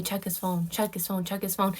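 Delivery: hushed, urgent repeating; soft inner voice. Retold or imitated. God urging her in the morning, soft and insistent. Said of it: "God's telling me".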